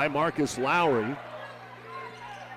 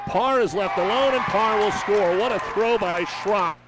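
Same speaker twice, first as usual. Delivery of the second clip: higher, louder burst of play-by-play; excited call; 5 semitones higher, 5 dB louder than usual. Real, not acted. Announcer calls the throw by Schropp to listeners, excited. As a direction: call the basket with a surge of excitement. Calling a score live.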